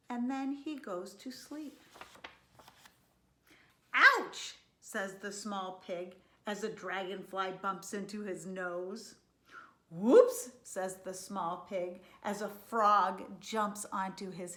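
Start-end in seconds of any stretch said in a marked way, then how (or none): 3.9-4.6 high, loud cry of pain; startled yelp. A narrator's reading. Pig bumped on the nose. 9.9-10.5 high, very loud exclamation; startled whoop. A narrator's reading. Frog jumps on pig's head.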